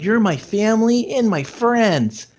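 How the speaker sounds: hammed-up earnest hero speech; mock Sonic voice. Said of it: "he's just kinda like".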